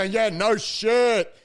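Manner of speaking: very slow, deadpan; slow drawn-out. Retold or imitated. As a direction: stretch each word out slowly. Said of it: "And I go".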